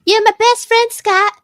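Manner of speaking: squealing high praise; high gushing thank you. Acted. Gushing thanks to player Scott.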